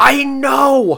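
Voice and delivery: high, loud exclamation; exasperated agreement. Real, not acted. Co-host agreeing about the missing shark punch, exasperated shout. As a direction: shout it in shared disbelief.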